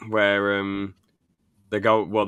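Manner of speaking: ordinary talk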